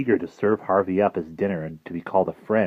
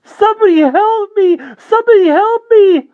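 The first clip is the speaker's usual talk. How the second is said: high screaming call for help, much louder; panicked cry for help; 13 dB louder than usual. Acted. Boy flees the vampire Harvey, screaming for help. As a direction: scream it like something is chasing you.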